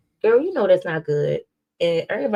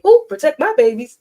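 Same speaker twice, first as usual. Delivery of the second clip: mocking quote; 9 semitones higher, 10 dB louder than usual. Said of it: "and say".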